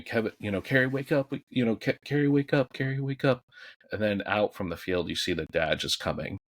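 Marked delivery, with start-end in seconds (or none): 0.0-3.4 high-pitched mock scream of a name, half-hearted; imitating a desperate brother's cry; retold screaming.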